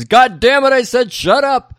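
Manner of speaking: loud, high-pitched mock scream; screeched, exasperated yell; retold scream. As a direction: explode in hammed-up fury. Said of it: "and then he screams".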